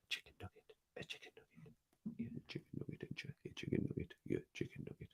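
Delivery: soft breathy whisper to the cat, very quiet. Real, not acted. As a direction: whisper it close, like a secret. Whispering pet name to cat.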